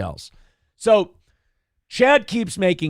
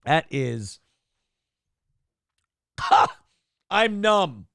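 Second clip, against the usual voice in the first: usual speaking voice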